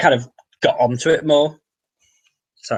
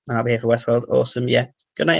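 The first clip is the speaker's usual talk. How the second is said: ordinary talk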